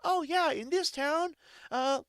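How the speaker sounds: high, slow, matter-of-fact local voice; cheery townsfolk. Retold or imitated. Locals explaining the town's customs, high breezy voice. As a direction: explain it like it's nothing odd. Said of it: "And they're like".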